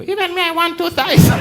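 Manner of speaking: high, thin little voice; a sneaky officer squeaking from behind; small voice. Acted. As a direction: pipe up like a tiny voice from behind. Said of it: "you hear a small voice behind you".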